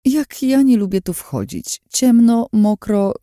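high, squeamish grumbling; disgusted complaint. Acted. Lin grumbles going down damp steps, disgusted. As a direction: complain in high-pitched distaste.

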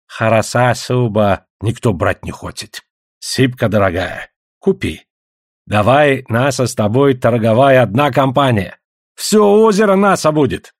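slow trader with lisped, broken Russian; put-on accented voice, rising to a boast at the end; wheedling native trader. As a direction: coax the buyer in accented Russian.

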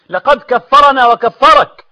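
high, loud, slow cry; alarmed outcry. Acted. Courtiers protesting to the caliph, loud slow outcry.

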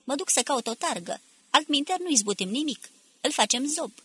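high-pitched, urgent warning; agitated. A narrator's reading. Paul goes for a stretcher, warning the others, agitated. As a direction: keep it agitated, voice lifted. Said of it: "strigă Paul agitat".

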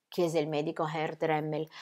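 plain reading voice

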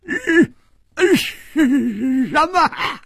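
high, loud outraged shout; stammering roar at full pitch; furious bellow. A narrator's reading. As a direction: explode in strangled disbelief. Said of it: "大喝道".

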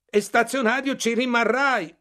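grido alto e molto forte, fuori di sé; urlo furioso. Acted. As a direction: perde la pazienza e urla. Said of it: "urlai, perdendo la pazienza". (il narratore perde la pazienza e urla a Bartleby)

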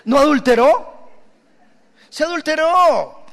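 "adulteró" hit high and loud; stress on ADULTERÓ. Acted. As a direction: punch "adulteró" as a rebuke. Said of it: "preacher punches the word". (énfasis fuerte en "adulteró")

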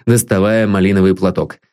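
plain reading voice

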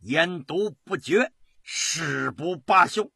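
impassioned public oath, slow and forceful; loud declaration. A narrator's reading. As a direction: proclaim it loudly, stretching each phrase. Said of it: "大声道".

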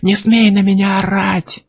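high, drawn-out protest; indignant wail. A narrator's reading. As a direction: wail it without extra volume. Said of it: "завопил Курт". (возмущённый вопль)

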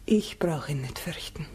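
whispered, steady courage; quiet resolve. Acted. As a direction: say it softly but firmly. Quiet resolve going into forest.

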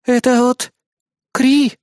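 high-pitched urgent news; urgent alert. A narrator's reading. Assistant naming the alarm's sender, urgent.

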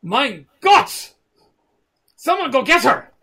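alarmed lord barking an order; German alarm. Acted. Lord Anton spots the girl and orders guards, German-accented alarm. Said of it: "he turns around and goes".

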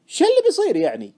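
high, loud challenge; exasperated question. Acted. Friend challenging the trader's hunch, exasperated. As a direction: demand to know, exasperated.

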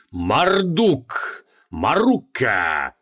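ritual cry, drawn out; exclaimed names. Acted. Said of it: "восклицал он".

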